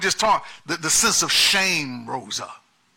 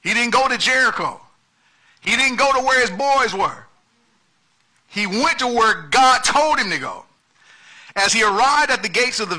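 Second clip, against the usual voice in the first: higher, louder preaching build; preaching at full force; 6 semitones higher, 4 dB louder than usual. Acted. Pastor driving home the point to the church, at full force. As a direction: drive the point home line by line. Preaching climax over music.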